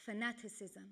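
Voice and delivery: soft broken-English "Fanaticism", falling away; hushed, lightly broken-English word. Acted. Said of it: "speaks in a broken tongue".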